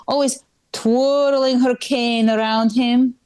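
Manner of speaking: put-on suspicious mother interrogating, heavy accent; Eastern European mom. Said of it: "she'll be like".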